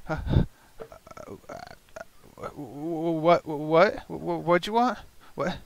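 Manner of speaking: a stuttering captive, mildly frightened; scared goblin. Acted. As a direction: goblin stammers at his captors. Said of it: "He's, he's scared to death right now".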